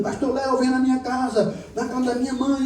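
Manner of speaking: high, pleading voice of a crying brother; a brother crying on the phone, begging; imitating crying plea. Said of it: "ligou pra mim chorando". (imitando um irmão chorando, agudo e suplicante)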